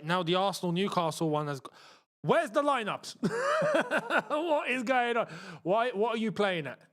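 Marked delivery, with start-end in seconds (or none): 3.2-4.2 laugh. Real, not acted. Streamer confused by broken lineups.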